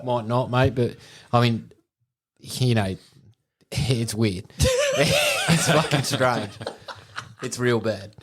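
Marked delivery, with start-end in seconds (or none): 5.0-6.5 slow, high, laughing through it; can't stop laughing while saying it. Real, not acted. Telling friends the odd story, laughing.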